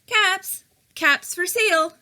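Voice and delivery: high, sing-song street cry of a cap seller; peddler calling out. A narrator's reading. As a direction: call your wares down the street, high.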